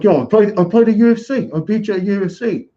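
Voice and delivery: very high mock British accent; hammed-up British accent, high and punchy. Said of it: "he talked in a British accent".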